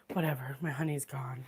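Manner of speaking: hushed mock-sad whisper; whispered lament. Real, not acted. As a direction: whisper it like a small tragedy. Lament for lost honey.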